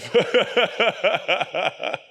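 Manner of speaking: big real laugh, high and loud; loud burst of laughter. Real, not acted. Host bursts out laughing at the misheard name. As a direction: crack up at the mix-up.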